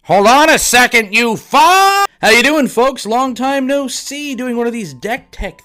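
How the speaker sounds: shouting high and loud; wild unhinged ranting. Acted. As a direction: snap out of it yelling. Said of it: "Wait, why does my voice sound".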